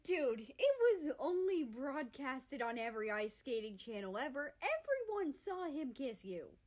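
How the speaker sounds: high, loud excited exclaim. A narrator's reading. Canada to America, loud excited exclaim. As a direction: insist it was everywhere. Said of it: "Canada exclaims".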